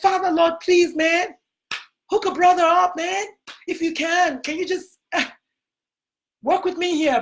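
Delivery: high, pleading whine to the Father; pleading Jesus. Retold or imitated. Jesus begging his Father to skip the trip, high pleading whine. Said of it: "He was like".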